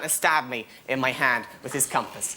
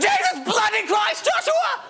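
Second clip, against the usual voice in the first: much higher, louder, slow shrieking mum; mum's exasperated yell; 5 dB louder, slower than usual. Retold or imitated. Mum finding his untidy room, shrieking much higher. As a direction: shriek it like a fed-up mother. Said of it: "that is an uncanny impression".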